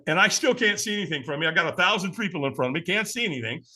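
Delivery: usual speaking voice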